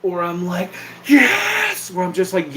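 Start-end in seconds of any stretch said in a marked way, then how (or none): 1.1-1.9 put-on high, drawn-out drunken holler; top-of-lungs yell. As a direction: stretch it into a long high yell. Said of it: "yelling at the top of my lungs like a drunk".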